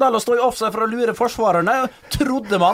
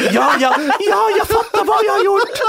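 much higher voice, laughing through it; Erlend's high voice; 13 semitones higher, 3 dB louder than usual. Retold or imitated. Young player answering the coach in a much higher voice, giggling. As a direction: squeak the meek answer while laughing. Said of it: "med, med lys stemme".

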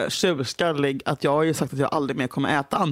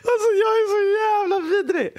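high, teary laughing words; laugh-crying. Real, not acted. Admitting to Julia she was awful, laugh-crying. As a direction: confess it through helpless laughter. Laughing at her own behaviour.

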